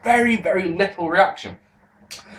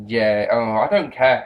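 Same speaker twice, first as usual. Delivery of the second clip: ordinary delivery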